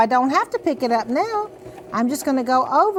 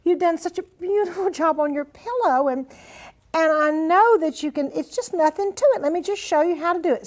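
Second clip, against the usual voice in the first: higher, gentle coaxing of a nervous student; reassuring; 5 semitones higher than usual. Retold or imitated. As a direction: soothe her like a scared child. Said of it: "I said".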